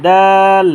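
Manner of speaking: দাল held long, loud and level; drawn three counts. Shown as a demonstration. Teacher models a letter for learners, loud and drawn out. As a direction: hold the vowel in দাল loudly. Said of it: "তিন আলিফ টেনে পড়বো".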